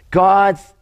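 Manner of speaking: ordinary talk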